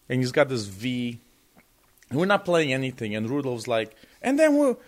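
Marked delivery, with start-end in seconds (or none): 4.2-4.8 put-on German accent. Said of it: "in his German accent is like".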